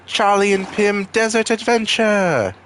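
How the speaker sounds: high, drawn-out title call; mock show announcer. Announcing their desert quest as a show, high mock-announcer voice.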